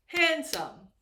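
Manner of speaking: "handsome" in two beats, high and loud; syllable by syllable. Shown as a demonstration. Teacher counting syllables for learners, "handsome" loudly split in two. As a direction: punch out both syllables of "handsome". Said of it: "we have two syllables here".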